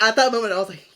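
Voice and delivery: normal talking voice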